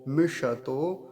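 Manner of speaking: मिषतो slow, unbroken, ष full; correct pronunciation. Shown as a demonstration. Teacher gives the right मिषतो after the wrong split. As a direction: keep मिषतो joined and slow. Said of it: "मिष तो नहीं कहेंगे".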